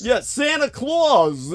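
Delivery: high, slow Brooklyn Santa Claus; Brooklyn accent. Shown as a demonstration. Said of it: "with that Brooklyn accent".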